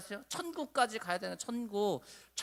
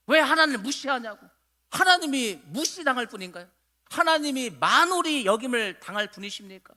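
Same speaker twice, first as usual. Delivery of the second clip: raised, insistent sermon voice; preaching at full force; 5 semitones higher, 6 dB louder than usual. Real, not acted. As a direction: pile up each phrase with rising intensity.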